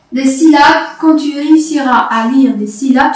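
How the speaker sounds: ordinary talk, nothing marked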